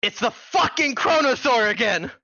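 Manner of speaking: high, hammed-up angry exclamation; exasperated outburst. Wizard revealing his nemesis to the party, exasperated outburst. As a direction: burst out in theatrical outrage.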